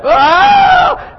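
sudden high wailing cry; high sharp imitated child's cry. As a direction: mimic a child's anguished wail. Child crying out after spanking.

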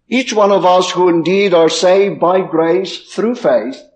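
ordinary talk, nothing marked

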